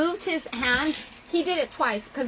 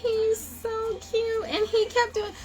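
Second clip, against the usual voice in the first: high, slow, near-tearful gushing; gushing coo; 10 semitones higher, slower than usual. Real, not acted. Watching her baby's video.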